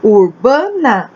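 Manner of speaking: Urbana said slowly, syllable by syllable; patient teacher pace; slow syllable demo. Shown as a demonstration. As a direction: draw out Urbana for the class. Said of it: "tem três sílabas".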